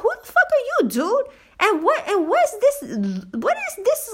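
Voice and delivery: high-pitched, incredulous outburst; outraged rant. Real, not acted. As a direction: rant in exasperated disbelief, pitch way up. Worked-up rant about being approached.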